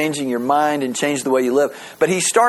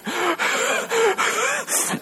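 high laugh; 4 dB quieter than usual. Real, not acted. Laughing at her own joke.

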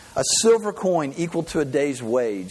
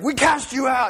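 exorcists shouting; 11 semitones higher than usual. Pretend. Sons of Sceva confront spirit.

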